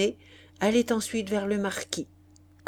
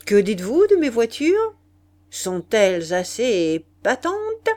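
marquis vantard; 3 semitones higher, 8 dB louder than usual. Acted. Le marquis vante ses voitures.

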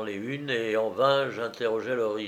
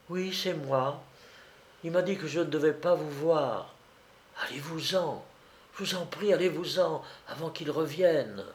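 put-on hushed young woman pleading, slightly higher; jeune fille suppliante; 4 semitones higher, 4 dB quieter than usual. A narrator's reading. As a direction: plead softly and urgently to be left alone. (jeune fille qui supplie tout bas)

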